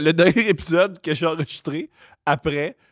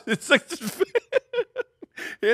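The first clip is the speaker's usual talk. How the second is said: squeaking with laughter; laughing; 13 semitones higher, much slower than usual. Real, not acted. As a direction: laugh through the question. Laughing while asking.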